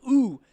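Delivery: hammed-up pained ooh; mock pained ooh. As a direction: fake a kick that never landed. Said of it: "the guy goes".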